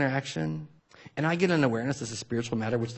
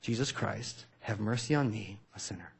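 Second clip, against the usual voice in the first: softer, slower devout chant fading out; hushed repeated prayer; 3 semitones lower, 7 dB quieter than usual. Shown as a demonstration. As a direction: murmur the prayer over and over, letting it fade. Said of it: "They do it again".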